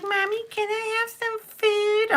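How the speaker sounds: put-on very high, pleading kid voice; imitated little boy. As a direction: mimic a child sweetly begging mom. Imitates her son asking for food.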